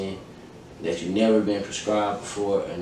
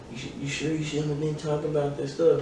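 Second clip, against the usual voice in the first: voicing mom; 6 semitones higher, 5 dB quieter than usual. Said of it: "She was like".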